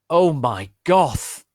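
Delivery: slow, loud, high hammy exclamation; shocked gasp. Acted. Slugborn reacting to Voldemort's broomstick, hammy shocked cry. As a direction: gasp it out in mock horror. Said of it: "Slugborn gasped".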